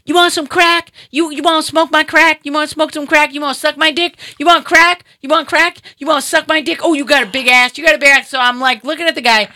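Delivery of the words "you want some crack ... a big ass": high, insistent pestering voice; high-pitched imitated street hustler; crazed crackhead. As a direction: mimic a man out of his mind, pushing his offer. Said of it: "he's messed up out of his mind".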